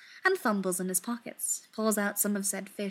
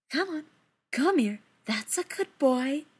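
higher, sweet coaxing to a pet; coaxing kitten; 5 semitones higher than usual. A narrator's reading. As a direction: lure the kitten over gently.

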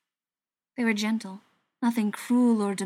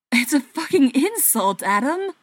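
loud, higher outburst of temper; angry yell; 5 semitones higher, 7 dB louder than usual. A narrator's reading. Tommy losing his temper with Adam, angry yell. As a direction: let the temper finally break loose in a yell. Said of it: "Tommy yelled".

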